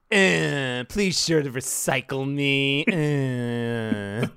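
long whine sliding downward; drawn-out droning robot voice; whiny robot powering down. Acted. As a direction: whine it out, then wind down like a dying machine. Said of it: "powering down at the every end of every sentence".

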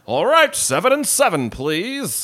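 high, loud, over-the-top stage actor; hammed-up, fully enunciated 1940s radio delivery; old-time radio voice. Birthday man orders his drink at the party, old-time radio voice. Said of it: "sounds like he's from a nineteen forties radio program".

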